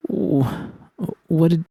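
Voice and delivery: high, broken, stammering fear; croaked panic. Acted. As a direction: choke out the question in terror. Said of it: "I croaked".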